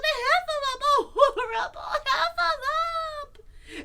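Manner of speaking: very high, loud squealing cry for help; panicked cry. A narrator's reading. Piglet sees the monster, high panicked screaming. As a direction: shriek for help in a tiny panicked voice. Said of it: "cried Piglet".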